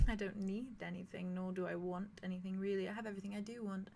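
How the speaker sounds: soft and unbothered; calmly content, almost murmured; quiet NPC voice. Acted. NPC declines the exchange.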